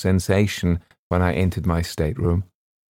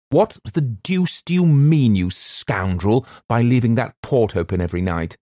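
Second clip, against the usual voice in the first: higher, heated rebuke of a steward; angry scolding; 4 semitones higher than usual. A narrator's reading. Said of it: "I was exceedingly wroth".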